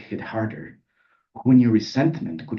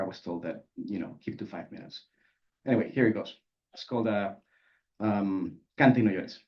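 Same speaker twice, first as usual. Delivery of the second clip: usual speaking voice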